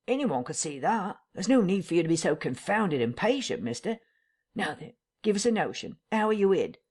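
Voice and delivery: a lightly voiced tramp, pressing; country vagrant wanting answers; rustic tramp, impatient. Tramp Marvel presses the invisible voice, a lightly voiced rustic tramp.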